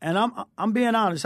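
ordinary delivery

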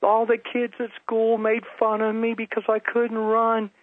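put-on high-pitched little boy voice, testifying; high, childlike testimony. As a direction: pipe up high like an excited child. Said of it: "there was a little boy".

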